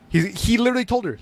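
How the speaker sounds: ordinary delivery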